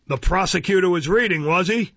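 Mister Fang, suspicious. A narrator's reading. Magistrate seizing on a new detail, suspicious.

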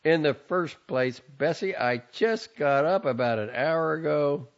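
loud, building tirade ending in a yell; angry son. A narrator's reading. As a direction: lose patience, shouting by the end. Son yells at mother.

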